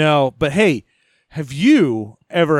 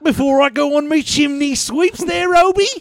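high-pitched hammed-up cockney chimney sweep; a cheeky cockney sweep begging for luck; cockney accent; 8 semitones higher than usual. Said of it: "England comes up and like".

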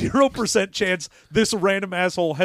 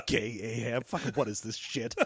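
much softer, lower grumbling; muttering to himself; 9 semitones lower, 13 dB quieter than usual. Retold or imitated. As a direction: grumble it under the breath while walking off. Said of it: "just muttering to himself".

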